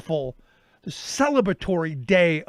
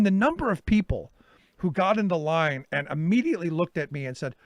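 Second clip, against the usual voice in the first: ordinary talk, nothing marked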